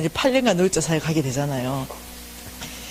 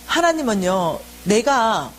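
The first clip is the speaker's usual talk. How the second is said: ordinary delivery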